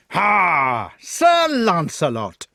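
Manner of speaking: loud, drawn-out gloating shout; fat knight's shout. A narrator's reading. Fat knight galloping up to his trapped foe, shouting. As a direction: bellow it slowly and high. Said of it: "shouted the fat knight".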